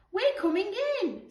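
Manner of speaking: excited heroic call; determined cry. Acted. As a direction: burst in like a rescuer. Sophie storming the house.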